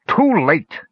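high, furious cry; a raging dwarf refusing his friend; in a rage. A narrator's reading. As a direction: fling the words out in rage. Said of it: "declared the dwarf in a rage".

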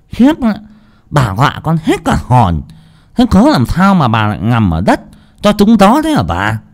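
high simpering lisp; fawning cook's lisping voice. Acted. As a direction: sweet-talk the mistress with a tongue-tie. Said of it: "dở cái giọng xun xoe, ... ngọng líu ngọng lô".

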